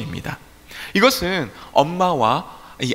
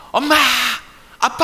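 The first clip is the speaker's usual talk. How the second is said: put-on child calling hard; 11 semitones higher, 3 dB louder than usual. Child yelling for parents' help, calling with full effort. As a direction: call out like a child needing help. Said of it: "힘을 막 내면서".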